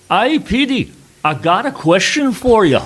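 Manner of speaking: high, loud Scottish soldier voice; a bluff Scottish guard calling across the table; Scottish accent. Retold or imitated. A Scottish security chief leaning over at breakfast, in a loud Scottish accent. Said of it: "I also loved your Scottish accent".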